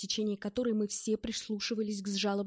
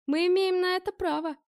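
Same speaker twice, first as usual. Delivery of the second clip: higher, louder cutting reply; curt; 10 semitones higher, 7 dB louder than usual. Acted. Bell curtly shuts down Ed's protest. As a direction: cut him off flat. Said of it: "отрезал Белл".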